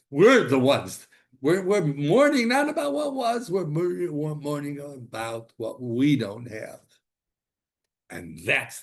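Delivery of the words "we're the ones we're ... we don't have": high, loud preaching that eases down; fervent preaching. Acted. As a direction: preach it with rising fire. Preaching at full force.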